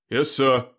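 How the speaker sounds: driver's brief hollow grunt. A narrator's reading. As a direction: grunt it back dutifully. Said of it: "came a hollow grunt".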